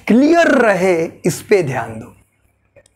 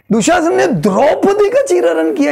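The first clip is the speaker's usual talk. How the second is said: Draupadi lifted and weighted, higher voice; stress on Draupadi; 8 semitones higher than usual. Shown as a demonstration. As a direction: lean on the name Draupadi. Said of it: "Draupadi के relative". (Draupadi शब्द पर ज़ोर)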